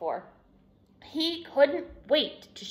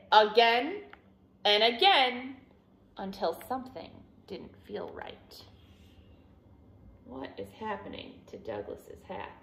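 plain reading voice

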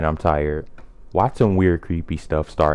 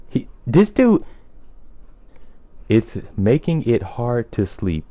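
high mock neighbor voice; 6 semitones higher than usual. Reading neighbor's line in voice.

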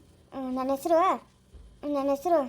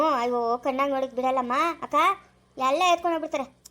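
normal talking voice

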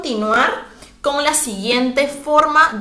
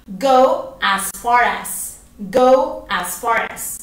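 slow, clear teaching repetition; patient teacher modelling a phrase; slow model phrase; much slower than usual. Shown as a demonstration. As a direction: Go as far as, each word slow and clear. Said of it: "¿Y cómo digo vaya hasta?".

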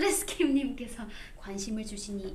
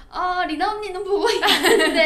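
laughing; 6 semitones higher, 11 dB louder than usual. Retold or imitated. Retelling own answer, laughing.

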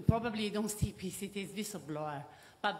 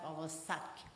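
usual speaking voice

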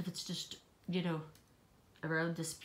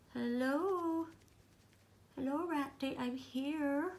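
doll voice; 5 semitones higher, slower than usual. Pretend. Shy doll Trudy voiced greeting.

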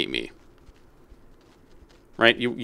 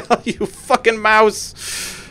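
exasperated; 11 semitones higher, much slower than usual. Real, not acted. Slow exasperated cry at mouse.

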